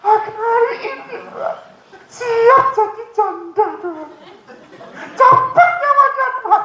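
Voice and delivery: high, slow, hammed-up wailing prayer; mock crying prayer. Wife prays in her room about her cruel husband, mock wailing. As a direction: wail it out like a wronged wife begging God.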